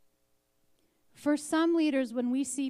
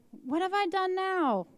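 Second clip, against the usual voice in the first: exasperated younger brother; 9 semitones higher, 5 dB louder than usual. Acted. David answers his angry brother.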